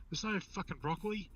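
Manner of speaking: mock-indignant punchline voice; retold exasperation. Exasperated customer in joke punchline.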